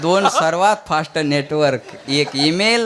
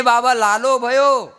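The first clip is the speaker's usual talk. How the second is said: higher, drawn-out happy announcement; acted village girl, pitched up; girl announcing news; 8 semitones higher, slower than usual. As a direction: call out the news to Baba, slowly. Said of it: "ती म्हणे".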